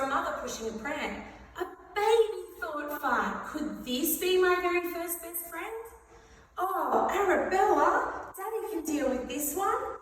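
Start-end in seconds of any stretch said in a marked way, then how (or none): 1.6-2.4 high delighted gasp; light up with joy; excited. Acted. Fart excited seeing baby. 3.5-5.8 hopeful excitement. Acted. Fart hopeful. 6.6-10.0 exasperated mum voice. Acted. Mother handing baby to dad.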